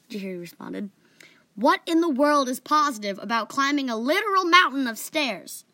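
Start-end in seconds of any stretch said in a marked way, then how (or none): 1.6-5.7 loud, higher exasperated outburst. Acted. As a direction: protest in loud disbelief. Exasperated retort at the climb.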